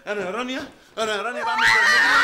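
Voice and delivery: loud, laughing outraged protest; indignant shout. Acted. Husband protesting to Rania, loud laughing outrage.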